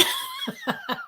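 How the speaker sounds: high, teary burst of laughter; tearful laugh. Real, not acted. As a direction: laugh off the tears. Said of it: "Keep it together".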